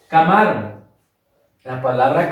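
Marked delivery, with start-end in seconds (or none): 0.1-0.8 "Camarón" wrongly stressed on the middle syllable, ca-MÁ-ron. Shown as a demonstration. Teacher trying the accent on ma, "Camarón" as caMÁron. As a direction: punch MA in "Camarón", dropping off on "ron". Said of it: "si yo lo ubico en la sílaba ma".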